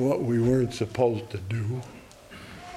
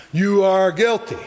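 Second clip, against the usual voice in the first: raised, high accusing holler; 5 semitones higher, 4 dB louder than usual. Acted. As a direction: holler the verdict out. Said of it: "hollering out".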